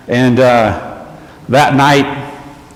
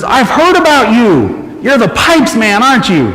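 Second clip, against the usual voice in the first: eager shopkeeper; 9 semitones higher than usual. Retold or imitated. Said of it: "And he says".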